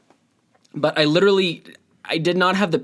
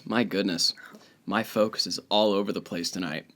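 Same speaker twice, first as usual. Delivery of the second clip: muttering; 6 semitones lower, 8 dB quieter than usual. Real, not acted. Muttering to self.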